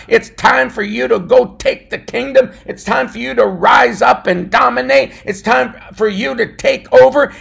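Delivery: loud, rising preacher's cry; fiery preaching. Acted. Pastor preaching to his listeners, building to a shout. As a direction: preach it with full force to the congregation.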